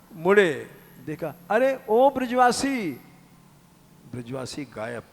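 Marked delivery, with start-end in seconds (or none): 1.5-3.0 higher, louder call after someone. Acted. Sadhu calling after the villager who vanished, louder call.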